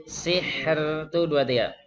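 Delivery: "Sihr" drawn out slowly, r held then a thin breath; slow model reading for students; slow demo. Shown as a demonstration. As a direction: spell out "Sihr" at teaching pace. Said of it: "baru kasih nafas tipis".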